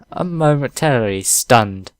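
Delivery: ordinary talk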